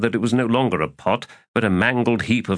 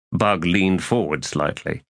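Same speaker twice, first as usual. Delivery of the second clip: ordinary delivery, nothing marked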